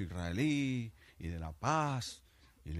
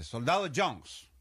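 commanding officer call; 6 semitones higher, 5 dB louder, much slower than usual. Acted. British officer calls soldier.

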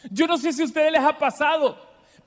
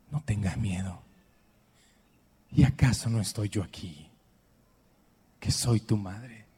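Virgin's tender words; 14 semitones lower than usual. Retold or imitated. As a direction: speak as a gentle mother soothing her child. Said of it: "¿Qué le dijo?".

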